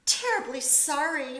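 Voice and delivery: ordinary delivery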